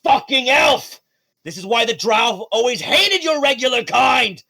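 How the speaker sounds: loud, high furious drow snarl; angry villain. Acted. Wounded Norman cursing Sakura, loud and furious. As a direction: spit your hatred at the elf. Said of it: "feels it, and he goes".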